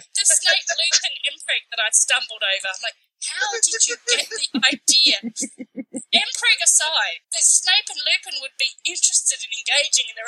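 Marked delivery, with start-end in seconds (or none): 3.2-5.2 higher, exasperated bewilderment; incredulous. Acted. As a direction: stretch it out in mock disbelief. Acting out disbelief at fanfic.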